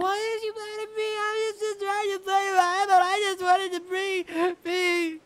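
very high, drawn-out fake crying; hammed-up squeaky lament, slowing down; mock wailing. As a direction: wail like an upset child. Mock tears at partner's anger.

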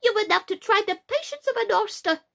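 put-on high-pitched, peevish young crab; snappish. A narrator's reading. Little crab fed up with her mother, snappishly. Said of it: "said the little crab a little snappishly".

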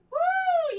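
loud high whoop; cheer. Real, not acted. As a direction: whoop to push through the burn. Cheering mid-workout burn.

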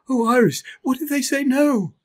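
high, anxious little elephant voice; a nervous calf afraid of rejection; worried Bobo. Acted.

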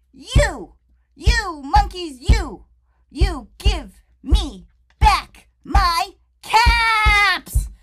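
loud, high and slow; furious shout. Acted. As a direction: stamp your foot and bellow. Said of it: "he felt quite angry".

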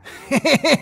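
shrill giggling villain laugh, loud; villain's high laugh. Acted. Ashy Slashy laughing at the escaped puppets, high cackle. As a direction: cackle like a delighted killer puppet. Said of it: "released a groan of frustration followed by a peal of laughter".

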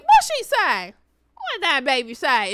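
high, loud grandma exclamation; nosy grandma. Retold or imitated. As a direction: lightly imitate an eager grandmother. Imitating grandma demanding an answer.

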